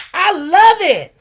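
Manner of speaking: loud, slow, high burst of delight; excited. Real, not acted. Vendor loves the customers' name for her bowls, loud and delighted. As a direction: shout your love for the name. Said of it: "vendor delighting in the name".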